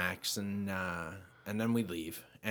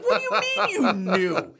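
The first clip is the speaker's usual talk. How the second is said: incredulous; 8 semitones higher, 8 dB louder, slower than usual. Retold or imitated. Disbelief at wife.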